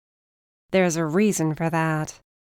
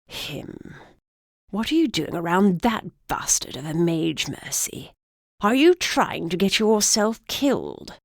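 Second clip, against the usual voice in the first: put-on old man voice. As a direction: rasp it out like a cranky old man. Old griffin alarmed.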